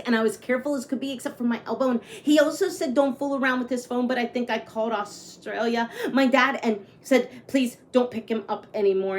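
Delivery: lively read-aloud storytelling; story narration. A narrator's reading. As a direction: read it like a picture book to kids. Reads picture book aloud.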